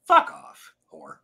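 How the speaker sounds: mock retort. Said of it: "retorting to the scene".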